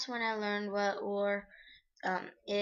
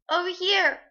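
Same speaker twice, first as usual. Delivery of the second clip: high, loud, hurting call; pained shout; 9 semitones higher, 13 dB louder than usual. Acted. Girl calls the mothers over, loud and pained. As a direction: call out through tears. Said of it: "I shouted with pain".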